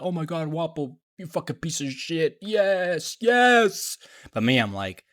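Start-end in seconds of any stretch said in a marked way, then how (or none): 0.0-4.0 imitating a hyped viewer, rising higher to a cheer; mock fan losing it over the villain; excited fan. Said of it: "they probably would be like".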